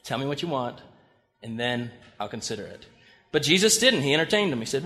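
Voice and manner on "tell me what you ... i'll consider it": quiet, guarded, fading to almost nothing; wary reply. Retold or imitated. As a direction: lower and softer, a skeptical pushback. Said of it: "I'm always like".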